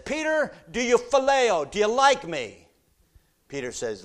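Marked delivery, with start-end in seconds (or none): 0.0-2.7 loud, very high plea; the Lord asking. Acted. The Lord asking Peter the third time, high and loud. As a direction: ask it a third time, pleading. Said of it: "the Lord says".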